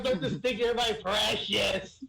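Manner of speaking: high pleading shriek; mock scream. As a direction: wail it high and slow. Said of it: "I'm gonna start screaming".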